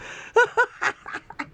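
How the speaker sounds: high burst of real laughter; big laugh. Real, not acted. Reacting to the Democrats' insult with a high laugh. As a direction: crack up at the post.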